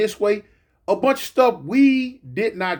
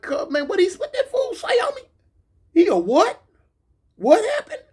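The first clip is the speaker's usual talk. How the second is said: much higher, laughing in disbelief; shocked holler; 12 semitones higher than usual. Retold or imitated. Shouting down the tier at a homie gone BGF, laughing in shock. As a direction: yelp it out like you can't believe your ears. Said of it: "'cause I'm like hollering".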